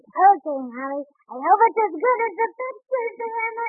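put-on high child voice, eager and sweet; a little boy asking his mother for the story. Baby Dumpling answering mother.

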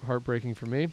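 ordinary talk

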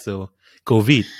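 "covid" said slowly with a French short o, ko-veed. Shown as a demonstration. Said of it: "it's the French accent".

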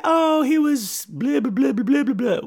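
high, slow babbling; high girlish voice. Retold or imitated. Girl tells the fisherman her dad's name, high and slow. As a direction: hum out the filler in a girl's voice. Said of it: "And she's like".

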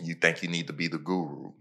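ordinary delivery

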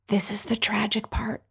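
hushed stage whisper; whispered aside. Acted. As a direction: confide it to the audience in a whisper. Mock-secret aside announcing tragic part.

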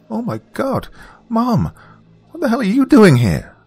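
put-on a teen caught out by her mom, mortified; mortified daughter. A narrator's reading. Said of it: "she asked, mortified".

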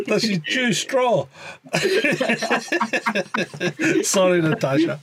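high giggly voice, laughing. Real, not acted. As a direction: laugh through the question. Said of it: "laughing at the impression".

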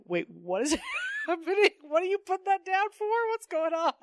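high-pitched, amused disbelief; mock bewilderment. Reader reacting to the weird handkerchief panel, mock bewildered.

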